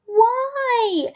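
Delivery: put-on high, drawn-out child's protest; indignant little kid's high query; questioning. A narrator's reading. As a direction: wail the question high and long. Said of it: "Ada questioned".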